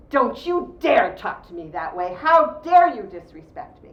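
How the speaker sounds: loud, slow, forceful scolding; triggered angry parent. Acted. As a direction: hammer each word like an outraged parent. Acting a triggered parent's reaction.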